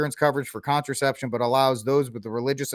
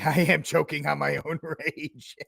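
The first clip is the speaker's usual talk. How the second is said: laughing; 4 dB quieter than usual. Real, not acted. Laughing through a choke.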